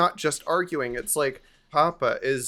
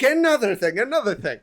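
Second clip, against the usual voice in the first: high, loud imitated shout of a mother in an argument; mock yell; 10 semitones higher, 7 dB louder, faster than usual. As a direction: shout it back down the hall. Mother yelling back at grandfather.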